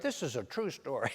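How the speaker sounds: normal talking voice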